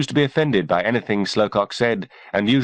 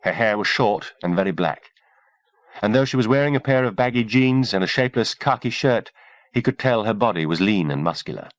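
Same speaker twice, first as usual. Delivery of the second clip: plain reading voice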